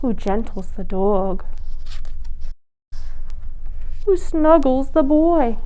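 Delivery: slow, gentle storybook lull; hushed slow bedtime read; soothing bedtime reading. A narrator's reading. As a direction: ease them toward sleep.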